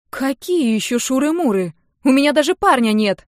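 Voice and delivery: high, loud indignant denial. A narrator's reading. Niece denies having any boyfriend, indignant. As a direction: protest the accusation at full volume. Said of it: "Возмутилась я тут же".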